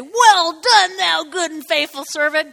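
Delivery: a touch of a grand master blessing his servant; the master's praise. Retold or imitated. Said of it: "He didn't say".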